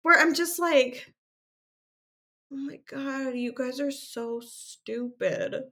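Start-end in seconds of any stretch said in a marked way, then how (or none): 2.5-5.6 high, drawn-out groaning exasperation; upset exasperation. Real, not acted. Podcaster scolding the teenage couple she watches, upset exasperation. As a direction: stretch the words in despairing disbelief. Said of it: "that's how upset I am about this".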